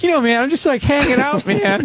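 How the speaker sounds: high-pitched hippie creature. Acted. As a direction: play a high-voiced stoner hippie. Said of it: "talking like a hippie".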